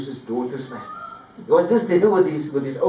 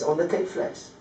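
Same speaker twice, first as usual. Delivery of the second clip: ordinary delivery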